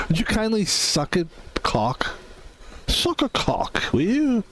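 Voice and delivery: squeaky mock-English insult; mock British accent. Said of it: "That's how they really talk".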